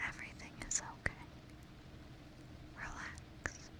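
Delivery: very soft, far-off whispered relax; ASMR trigger words, whispered very softly; close-up trigger words. Pretend. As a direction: breathe each relax right into the mic. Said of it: "Some close-up trigger words?".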